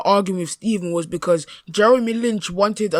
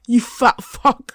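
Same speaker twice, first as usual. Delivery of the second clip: slow, higher mocking jibe; mocking insult; 4 semitones higher, slower than usual. Mimicking Bossman once True Geordie is far away, mock taunt. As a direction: imitate a man bravely insulting from safety. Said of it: "he wants to be like".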